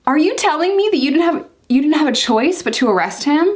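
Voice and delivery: high-pitched incredulous challenge; indignant disbelief. Retold or imitated. Woman challenging officer who arrested her boyfriend, indignant and incredulous. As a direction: throw it back at them, pitched well up.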